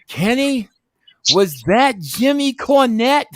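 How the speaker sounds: voicing Russo. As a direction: stretch it out like a thrilled host. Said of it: "Russo's next word out of his mouth was".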